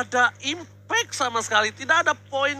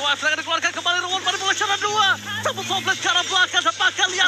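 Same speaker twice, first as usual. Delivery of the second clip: excited; 7 semitones higher, faster than usual. Real, not acted. Caster hypes the fight.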